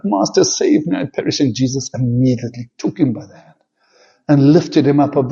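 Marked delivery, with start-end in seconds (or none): 0.0-1.4 higher, urgent cry of a sinking man; cried out. A narrator's reading. Peter sinking in the sea, crying out higher. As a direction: call out as if going under the waves. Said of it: "he cried out".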